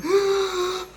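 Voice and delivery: sharp high gasp like a woman sobbing; imitated sobbing gasp. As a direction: mimic the wailing woman's catch of breath. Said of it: "Parang ... ganun". (hikbing ginagaya)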